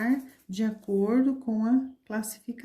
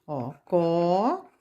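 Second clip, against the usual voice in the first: slow syllable demo; much slower than usual. Shown as a demonstration. Teacher to children sounding out a word slowly. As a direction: stretch each syllable of copo slowly. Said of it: "Ó, ficou copo".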